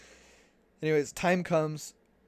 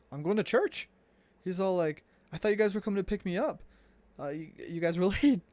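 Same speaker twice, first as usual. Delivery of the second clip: excited protest; 6 semitones higher, 4 dB louder than usual. Retold or imitated. Alejandro teasing they were late.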